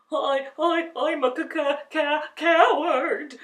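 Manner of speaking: scared stammer. A narrator's reading. Lion stammers to the ball of fire. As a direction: stammer it in fear. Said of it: "stammered the lion".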